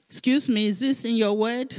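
high, sharp rhetorical challenge; mock indignant challenge. Pastor challenging the congregation, high and indignant. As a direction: raise your voice in mock disbelief.

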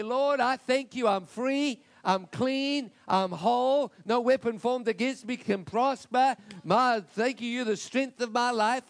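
loud, rising prayer of thanks; joyful loud confession of faith; fervent declaration. Pretend. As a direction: proclaim it like you mean it. Preacher voices a declaration prayer.

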